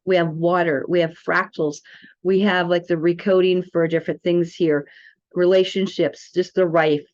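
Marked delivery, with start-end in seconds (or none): none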